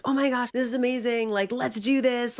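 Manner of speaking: high, bubbling with excitement; gushing, can't hold the joy in; excited. Retold or imitated. Wife pitching the podcast to her husband, excited and high. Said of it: "I'm super excited".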